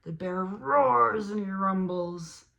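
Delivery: hammed-up bear fury; loud roaring read. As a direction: boom it out like the bear. Bear rampaging.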